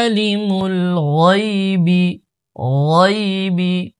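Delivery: slow recitation with a heavy ghayn opening ওয়াইবি; thick ghayn. Shown as a demonstration. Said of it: "ওয়াইনের উচ্চারণ মোটা". (ওয়াইবি মোটা গাইনে টেনে পড়া)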